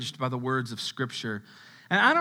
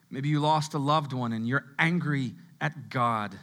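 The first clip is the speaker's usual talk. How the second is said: ordinary talk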